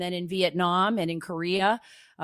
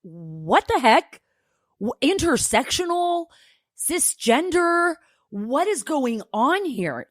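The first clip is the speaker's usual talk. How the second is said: high, incredulous questions drawn out slowly; real shock, each word stretched and pitched up; stunned disbelief; 4 semitones higher, slower than usual. Real, not acted. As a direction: react like you can't believe what you just heard. Reacting to the played video.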